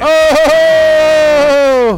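very high, loud mock gasp; exaggerated oh. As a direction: squeal the oh in fake surprise. Reacting loudly.

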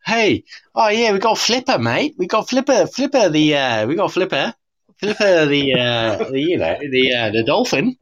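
a British guest lampooning an Australian kids' show, mock Australian accent. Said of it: "the Australian version would be".

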